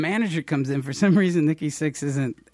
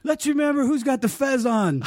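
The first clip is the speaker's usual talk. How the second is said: mock shout; 11 semitones higher than usual. Mock director yelling about hat.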